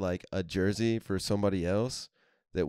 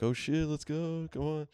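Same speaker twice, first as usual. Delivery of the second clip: higher, slow drawn-out cheer; mock cheering fans; 8 semitones higher, slower than usual. Buddies in the front row urging the team on, slow high cheer. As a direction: egg the team on, dragging it out.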